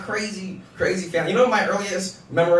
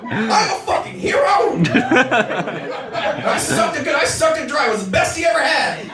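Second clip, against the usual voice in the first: mock heroic boast. Real, not acted. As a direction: crow about it like a hero.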